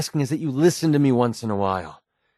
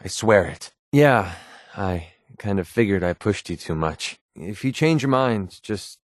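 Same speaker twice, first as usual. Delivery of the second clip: ordinary talk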